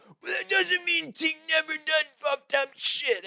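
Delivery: high-pitched jock imitation; mock indignant yell. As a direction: argue back in a strained high shout. Mimics rival jocks arguing back.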